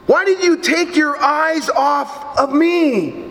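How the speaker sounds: ordinary delivery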